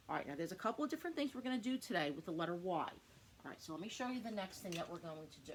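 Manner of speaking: ordinary talk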